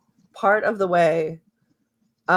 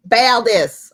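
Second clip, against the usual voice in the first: name called out louder in a southern accent; 5 semitones higher, 8 dB louder than usual. Shown as a demonstration. Game master naming a character, southern accent. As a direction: try a southern drawl on the name. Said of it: "give you a southern accent".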